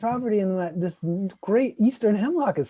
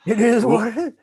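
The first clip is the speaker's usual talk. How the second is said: mock shrug; 7 semitones higher, 10 dB louder than usual. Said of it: "mocking the neighbor's shrug".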